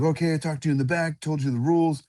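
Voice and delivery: high, rattled-off referee routine; referee's spiel. Retold or imitated. Referee instructs two fighters at the staredown, imitated spiel. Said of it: "he does his spiel".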